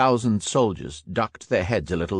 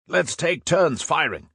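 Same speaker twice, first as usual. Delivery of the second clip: raised, slow battle shout over gunfire; shouted order; 8 semitones higher, slower than usual. A narrator's reading. Veteran to Hals over the guns, shouting. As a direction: bark it over the machine guns, drawn out. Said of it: "the veteran shouted to Hals".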